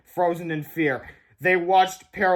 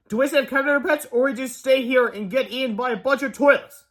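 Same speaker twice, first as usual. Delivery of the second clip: higher, agitated questioning; urgent dilemma; 7 semitones higher, 4 dB louder than usual. A narrator's reading. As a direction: press for a decision. George poses urgent dilemma.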